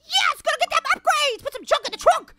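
high, eager whoop of words; excited cheer. Real, not acted. Player about to get upgrades, excited cheer. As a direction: burst out cheering.

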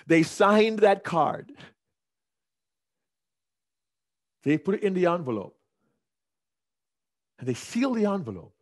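each step spaced and stressed; deliberate dramatic storytelling; emphatic. Acted. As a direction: build the reveal beat by beat. Slow emphatic revelation.

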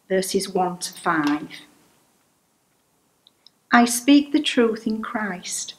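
ordinary talk, nothing marked

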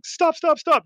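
mock yell, quick and high; retold yell. Local woman warning a soldier off a tripwire, mock yell. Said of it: "yell at me going".

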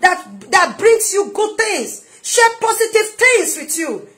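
loud, high-pitched impassioned exhortation; full-force preaching. Real, not acted. Preacher urging listeners toward supportive people, full-force preaching. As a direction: build to shouting, stretching the final phrases.

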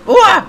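high loud ua yelp; startled yelp. Real, not acted. Gamer hit by surprise, loud high yelp. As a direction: jump at the sudden hit. Sudden crash in game.

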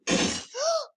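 high startled gasp; sharp gasp. Real, not acted. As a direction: gasp in shock. Sudden death in game.

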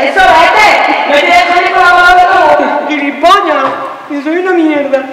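high, loud, drawn-out yelling; hammed-up furious screaming; screaming after him. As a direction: hurl insults at someone walking out. Yelling after departing boyfriend.

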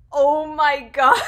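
slow, loud oh my God breaking into laughter; real laughing disbelief. Real, not acted. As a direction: crack up at something absurd. Disbelief after reading a tweet.

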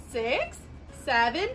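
ordinary delivery

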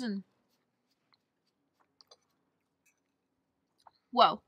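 talking between bites; chewing while speaking; mouth full of cake. Real, not acted. Seen 0: Cuts cake, forks bite in at 5.2, chews through 7.3.

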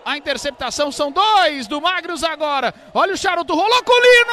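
rising to a shout as the attack closes in; building excitement. Real, not acted. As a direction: build from lively to all-out yell. (narração empolgada crescendo)

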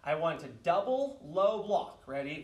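usual speaking voice

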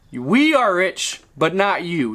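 "We" slowly leaned on, then "not" hit hard; pointed stress on "We" and "not". Shown as a demonstration. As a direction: drag out "We are rich", punch "not". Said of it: "Keyword, we are".

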